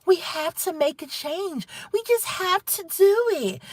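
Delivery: high, soft singsong imitation; mock lullaby voice. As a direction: mimic a politician's soothing lullaby voice. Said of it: "swayed by her lullaby voice".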